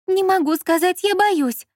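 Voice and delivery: high, distressed woman's voice; tearful and frightened. A narrator's reading. Lola confiding to a director by phone, tearful. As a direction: plead as if near a sob. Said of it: "Лола всхлипнула".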